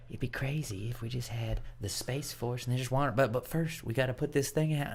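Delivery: soft, hushed attempt at a famous president's voice; bad Ronald Reagan. Joking president pitches Space Force to friends, bad Reagan impression. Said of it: "doing a really bad Ronald Reagan".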